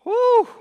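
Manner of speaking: high, loud exclaimed whoop; loud "woo". Real, not acted. Preacher reacting to a hard verse, loud high whoop. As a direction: let out one big high "woo".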